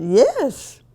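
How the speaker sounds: put-on squeaky, jittery yes from a nervous young actress; voice too high. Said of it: "my voice going ten octaves too high".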